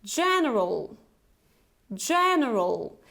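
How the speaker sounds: "general" with two quick schwas; model word. Shown as a demonstration. Teacher reading the word for learners to repeat. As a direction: say "general" with both middle and end vowels reduced. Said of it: "And the transcription".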